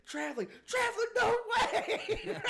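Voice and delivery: high, loud laughing yell of disbelief; mock outrage at the referee, laughing through it; outraged yell. Player yelling at the ref over a travel call, high and laughing. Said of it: "He goes, he goes".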